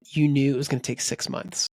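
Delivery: ordinary talk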